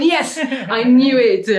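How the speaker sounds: high, loud, slow laughing yes; triumphant gotcha. Retold or imitated. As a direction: crow it like you were proven right at last. Girl crows on finally being told.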